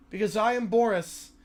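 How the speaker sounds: low, deadpan Boris voice; calm Boris. Acted. Boris explaining to the fan why he is calm, low deadpan. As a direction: state it like nothing matters, low.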